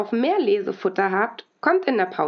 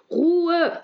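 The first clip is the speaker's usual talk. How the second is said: stern shout; 8 semitones higher than usual. A narrator's reading. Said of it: "ruft Frau Tabak". (laut gerufen)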